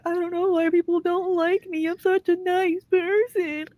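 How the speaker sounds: sing-song self-pity, hammed up; mock whiny victim. Mocking a streamer's fake innocence, high whiny. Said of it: "And then you walk around saying".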